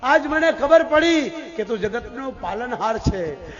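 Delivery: loud, drawn-out appeal to Krishna; fervent supplication. Retold or imitated. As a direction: build each realisation into a slow, ringing declaration. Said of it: "Kunta ખોળો પાથરીને કે".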